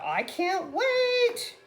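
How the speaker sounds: high, drawn-out, belting showbiz voice. Acted. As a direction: belt it out big, holding the last word. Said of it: "That's my [name], [name] Mer-, [name] voice".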